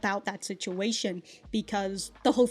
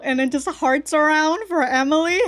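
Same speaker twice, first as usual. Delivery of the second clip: excited; 6 semitones higher, 8 dB louder than usual. Real, not acted. Excited fan reaction.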